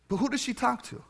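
ordinary delivery

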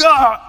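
loud, high burst of a yell; mock-heroic attack cry; battle yell. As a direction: yell as you burst through the door. Parent bursting through the door.

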